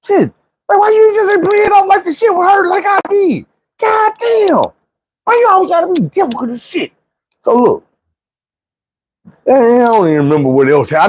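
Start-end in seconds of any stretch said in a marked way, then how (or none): 0.0-6.9 put-on high-pitched, exasperated kid complaining; mock whiny kid. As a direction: whine at the sister as a scared kid. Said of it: "Now I'm like".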